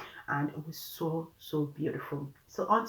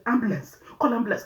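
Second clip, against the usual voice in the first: panicked call; 6 semitones higher, 5 dB louder, faster than usual. Retold or imitated. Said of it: "because she was just".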